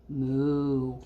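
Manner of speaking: low, drawn-out moo; cow moo. Acted. Teacher voicing the typing cows, low moo.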